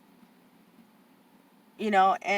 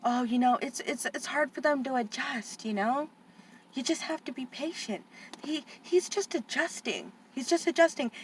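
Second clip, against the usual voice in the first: higher, softer soothing voice; patronizing lady; 5 semitones higher, 7 dB quieter than usual. Retold or imitated. Aunt mocking the school lady's patronizing calm, high and soft. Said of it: "she just like".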